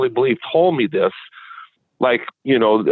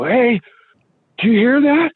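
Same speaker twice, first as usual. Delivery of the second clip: high-pitched, rising question to a tentmate; urgent retold call; 12 semitones higher, 3 dB louder than usual. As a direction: reenact a startled, higher-voiced check.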